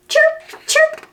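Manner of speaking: very high chirping; bird chirps. Acted. Voicing swallows for a child, high chirps.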